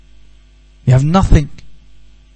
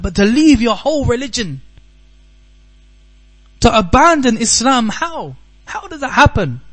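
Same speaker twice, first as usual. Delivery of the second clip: higher-pitched disbelief; incredulous; 6 semitones higher than usual. Real, not acted. Preacher baffled that someone abandons Islam, incredulous. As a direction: ask it higher, unable to fathom it.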